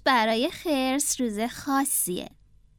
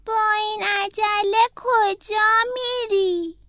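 higher, slow drawn-out snail voice; the snail asking Franklin where he is rushing; 6 semitones higher, slower than usual. Acted. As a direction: ask it slowly like a sluggish snail.

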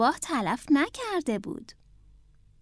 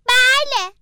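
higher, louder eager shout; shouted yes; 9 semitones higher, 8 dB louder, much slower than usual. Acted. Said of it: "فرانکلین فریاد زد".

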